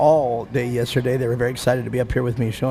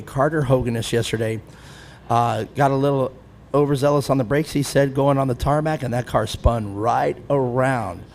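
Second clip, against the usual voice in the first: ordinary talk, nothing marked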